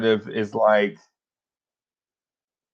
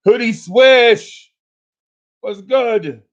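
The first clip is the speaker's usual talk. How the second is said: higher, louder, slow shout-out; calling out; 9 semitones higher, 5 dB louder, slower than usual. Real, not acted. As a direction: call the name out big. Calling out a chat name.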